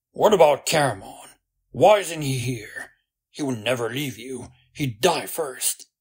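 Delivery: loud, indignant questioning; heated demand. A narrator's reading. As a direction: fire the questions out hotly. Heated demand about missing friend, flushed face.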